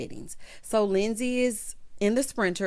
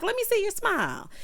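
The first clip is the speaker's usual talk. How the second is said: high, coaxing mock-bride voice; imitated eager bride; 11 semitones higher than usual. Bride asking to see groom's smile, mock eager. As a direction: pitch it up as a playful demand.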